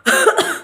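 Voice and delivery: a quick sharp demo cough; spasmodic cough demo. Shown as a demonstration. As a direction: cough once to show a spasmodic cough. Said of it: "spasmodic cough".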